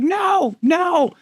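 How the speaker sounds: high shout over the rotors. Retold or imitated. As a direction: yell it to wave him off. Shouting over helicopter noise.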